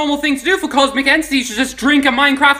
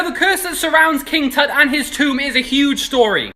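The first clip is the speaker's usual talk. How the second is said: ordinary talk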